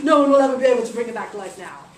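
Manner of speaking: loud, fast triumphant gloat; gloating villain. Acted. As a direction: crow over your victory. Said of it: "So he's like".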